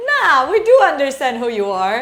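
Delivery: a hint of a laughing guy voice; kitchen nerd. Nerds in kitchen quoted.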